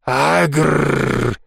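long rolled-r mock growl; villainous growl. Boy playing Mr Hyde at his friend, growling. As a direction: play a devilish monster growling. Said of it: "изобразил дьявольскую усмешку и сказал".

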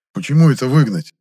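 loud, startled objection; indignant protest. A narrator's reading. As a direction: jump in with an affronted question. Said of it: "сразу же подскочил Моня".

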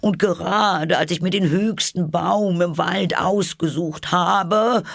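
very high bird voice, complaining; a fussy bird listing its troubles; aggrieved pigeon. A narrator's reading. Pigeon voice, held figure.

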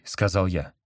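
plain reading voice

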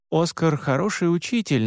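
plain reading voice

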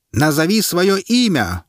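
high, slow commanding call; herald's demand. A narrator's reading. Herald demands the prisoner name himself, high and slow. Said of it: "потребовал глашатай".

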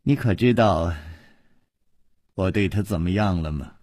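low, softly menacing question; quietly wicked teasing; venomous amusement. A narrator's reading. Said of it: "他的眼中忽然露出了恶毒的笑意".

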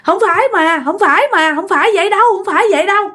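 high-pitched, pleading repetition; acted sobbing plea; tearful denial. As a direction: protest frantically as if crying. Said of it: "cô khóc quá trời luôn".

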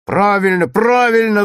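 loud, high shouts of agreement; class clamour. A narrator's reading. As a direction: clamour in support, pitched high. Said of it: "зашумел класс". (шумные выкрики одобрения)

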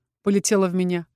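ordinary delivery, nothing marked

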